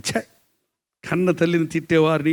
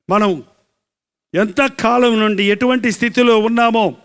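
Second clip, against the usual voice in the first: raised, ringing sermon phrases; full-force preaching; 6 semitones higher, 6 dB louder than usual. Acted. Preacher assuring the congregation that God sees them, full-force preaching. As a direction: proclaim it, pitched high with drawn endings.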